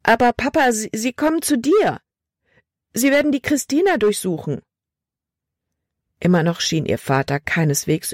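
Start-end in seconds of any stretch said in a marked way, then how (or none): 0.0-4.6 high, urgent daughter's voice; alarmed pleading. A narrator's reading. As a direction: press Papa with rising alarm. Libby pleads, alarmed, with father. (aufgeregt, hoch und drängend)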